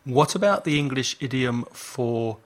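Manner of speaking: ordinary talk, nothing marked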